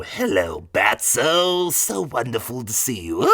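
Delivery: high, loud manic villain voice; sing-song menace with a giggle; Joker voice. Retold or imitated. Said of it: "almost like Mark Hamill's Joker".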